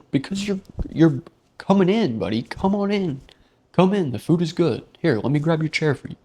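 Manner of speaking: high, loud, eager fake waiter; mock waiter. Narrator playing a fawning waiter to the guy, high and loud. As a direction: overdo the helpful host, fast at the end.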